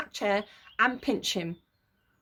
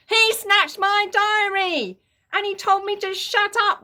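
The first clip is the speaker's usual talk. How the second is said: high, slow, loud wail; wailing complaint; 10 semitones higher, 6 dB louder than usual. A narrator's reading. Peter wailing to Mum about Henry. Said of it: "wailed Peter".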